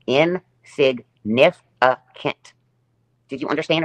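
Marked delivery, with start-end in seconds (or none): 0.1-1.0 "Insignificant" syllable by syllable, a long pause after each; real slow. Shown as a demonstration. Mocking a rival with a big word, real slow. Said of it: "gonna say it real slow".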